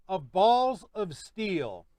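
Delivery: Balls of Steel said slowly, each word weighted; slow emphasis. Real, not acted. Announcing winner title.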